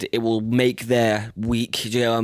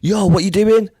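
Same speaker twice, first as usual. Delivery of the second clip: raised, higher-pitched challenge; calling someone out; 10 semitones higher, 5 dB louder than usual. Retold or imitated. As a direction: act out a loud, higher-pitched confrontation. Acted confrontation at his house.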